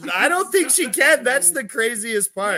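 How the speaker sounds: high, loud excited talk. Real, not acted. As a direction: pitch it up with excitement.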